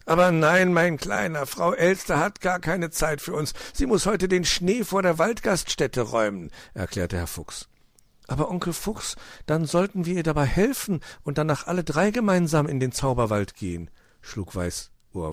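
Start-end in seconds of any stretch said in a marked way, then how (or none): 0.1-6.5 put-on higher, emphatic explaining to a child; lively fox uncle, emphatic start; dismissive fox uncle. A narrator's reading. 8.3-13.9 lightly voiced child, higher; a hint of eager young fox; little fox suggesting. A narrator's reading.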